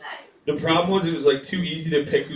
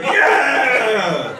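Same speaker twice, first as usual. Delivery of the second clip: crowd yell; 6 semitones higher, 3 dB louder than usual. Retold or imitated. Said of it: "everyone's like".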